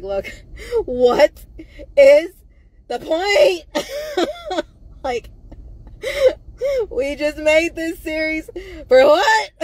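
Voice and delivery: half laughing, half crying in despair; laughing and crying at once; laughing through tears. Real, not acted. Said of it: "I'm laughing right now".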